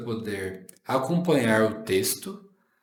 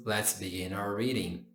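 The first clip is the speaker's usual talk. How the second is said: ordinary delivery, nothing marked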